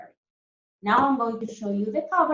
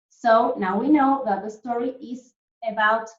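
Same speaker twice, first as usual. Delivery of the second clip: ordinary talk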